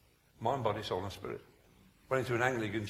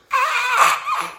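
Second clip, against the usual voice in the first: high, loud yelled "Ah"; sudden scream; 14 dB louder than usual. Retold or imitated. Preacher imitates old man in care home, sudden scream. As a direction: burst into a piercing scream.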